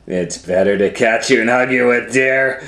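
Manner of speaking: wolf growing bold. Acted. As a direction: let the wolf nearly give himself away. Wolf grows bolder.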